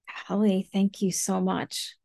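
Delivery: ordinary talk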